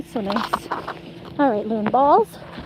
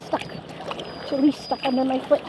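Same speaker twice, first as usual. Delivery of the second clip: ordinary talk, nothing marked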